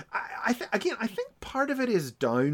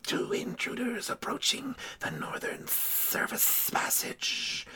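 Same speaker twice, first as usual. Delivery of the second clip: snake whisper; 11 semitones higher, 10 dB quieter than usual. Retold or imitated. Snake messenger reports.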